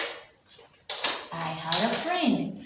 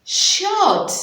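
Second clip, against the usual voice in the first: high loud "Short"; triumphant blend; 10 semitones higher, 8 dB louder than usual. Shown as a demonstration. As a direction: announce the blended word proudly. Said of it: "let me say".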